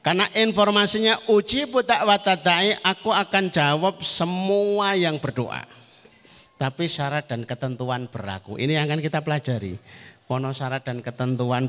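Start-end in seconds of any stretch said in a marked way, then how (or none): 0.0-5.6 loud, raised-pitch sermon delivery; high-energy religious oratory; forceful preaching. Real, not acted. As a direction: preach it out to the whole crowd.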